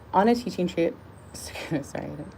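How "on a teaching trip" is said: words slipping into an odd accent; accidental accent on "On a teaching trip"; unintended odd accent. Real, not acted. Reader stumbles into devotional, accidental odd accent. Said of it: "I don't know what accent that is".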